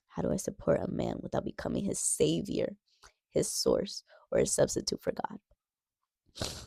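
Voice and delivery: soft unvoiced whisper, slowing at the end; whispered re-read. Shown as a demonstration. Rereading the viewer's question to herself, whispered. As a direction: breathe the question again under your breath.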